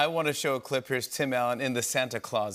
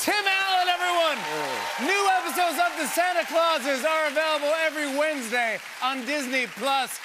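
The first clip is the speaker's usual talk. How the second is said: high, loud, projected host outro; show announcer sign-off; 13 semitones higher, 4 dB louder than usual. Real, not acted. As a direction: call it out over the applause to the audience. Host's sign-off over applause.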